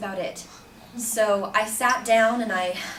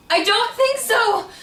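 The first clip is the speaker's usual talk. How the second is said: loud, high, drawn-out protest; outraged refusal; 13 semitones higher, 8 dB louder, slower than usual. Retold or imitated. Student refusing the man grabbing her, loudly indignant. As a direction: push him away with a raised, stretched cry.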